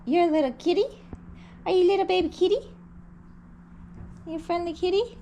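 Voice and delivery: high cooing kitty voice. Real, not acted. Baby talk to kitten.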